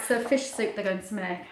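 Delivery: plain reading voice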